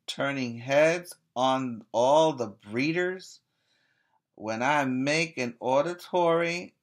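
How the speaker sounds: swinging read-aloud; rhythmic poem reading. Acted. Reading the dog's strut to the children, rhythmic. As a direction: strut through the lines. Reads rhyming dog poem.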